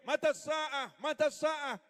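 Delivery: a rough villager shouting a question mid-lecture. Retold or imitated. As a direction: yell out to interrupt the gathering. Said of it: "langsung teriak".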